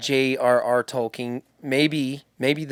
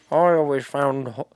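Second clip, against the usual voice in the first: Gandalf impression. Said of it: "how does he talk?".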